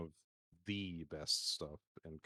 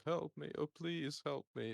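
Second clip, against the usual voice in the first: higher, slow mock plea for help in a lightly voiced pleading soldier; lightly voiced desperate game character begging; pleading guy from the game; 9 semitones higher than usual. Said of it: "like the guy who goes".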